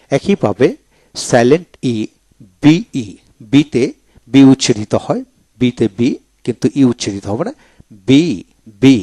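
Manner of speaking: ordinary delivery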